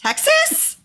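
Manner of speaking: high puzzled rising Texas; confused question. Retold or imitated. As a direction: ask it high and baffled. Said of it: "I'm like".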